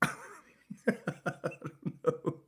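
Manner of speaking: breathless giggle through words; laughing, soft and airy. Real, not acted. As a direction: laugh it out, barely voiced.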